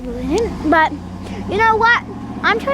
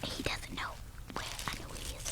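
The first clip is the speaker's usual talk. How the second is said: hushed whisper so the cat won't hear; 25 dB quieter, faster than usual. Real, not acted. Kid sneaking up on the hidden cat, whispering.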